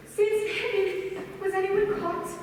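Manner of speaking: jolted, anxious questions; alarmed. Acted. Madeleine hearing the radio station was found, alarmed. Shocked at news of raid.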